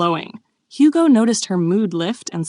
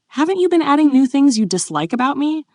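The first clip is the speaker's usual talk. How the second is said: higher plea settling into resignation; hurt; 8 semitones higher, 4 dB louder than usual. A narrator's reading. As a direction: accuse with a touch of wounded feeling. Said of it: "he said, clearly hurt".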